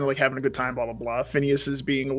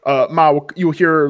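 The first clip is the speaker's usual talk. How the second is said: normal talking voice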